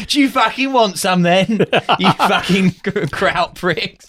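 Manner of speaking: high, laughing hammed-up British yob voice; mock brawler taunt. Riffing on a silly Band of Brothers scene, laughing mock yob taunt. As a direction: goad them like a pub brawler, cracking up.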